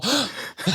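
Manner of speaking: imitated shocked gasp; audible gasp. Retelling the crowd's reaction to a misheard word, mock gasp. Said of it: "I heard audible".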